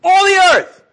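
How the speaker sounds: high, loud, slow proclaiming; a joyful loud proclamation; joyful shout. Shown as a demonstration. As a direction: call it out to the whole earth. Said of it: "the instructions here are".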